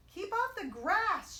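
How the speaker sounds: slow, high, loud shout. A narrator's reading. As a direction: order the cowboy off sharply. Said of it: "shouted Hank".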